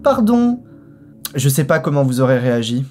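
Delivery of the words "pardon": put-on very high old lady voice, caught out and sorry; gasp, then apologize in a high old lady voice; surprised old lady apologizing. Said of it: "elle fait".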